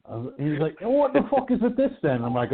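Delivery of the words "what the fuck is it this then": imitating friend's British accent, much higher; hammed-up Brit; mocking drunk Brit voice. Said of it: "He's gone full Brit on me".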